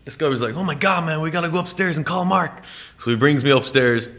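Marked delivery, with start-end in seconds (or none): 0.5-2.6 much higher, excited mock gush. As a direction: gush like a starstruck fan. Starstruck guy quoted.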